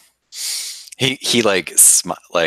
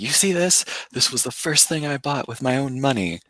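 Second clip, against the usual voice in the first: high, showing-off bar brag; proud drunken boast; 10 semitones higher than usual. Retold or imitated. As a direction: show off the clip, tipsy and proud.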